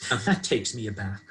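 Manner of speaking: ordinary delivery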